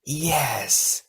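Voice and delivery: drawn-out, high wailing creature; second eerie voice. A narrator's reading. Second imprisoned queen joins the first, wailing. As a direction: stretch it into an eerie wail. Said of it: "a second voice joined the first".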